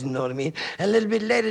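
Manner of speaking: ordinary talk, nothing marked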